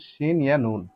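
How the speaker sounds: ordinary talk, nothing marked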